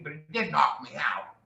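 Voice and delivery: ordinary delivery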